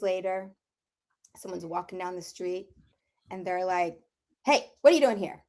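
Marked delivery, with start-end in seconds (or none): 4.4-5.4 rude attitude. Retold or imitated. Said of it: "All rude attitude".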